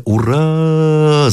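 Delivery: long, high, held cheer; a drawn-out battle hurrah; боевой клич. A narrator's reading. Russian battle cry in narration. (протяжное «ура»)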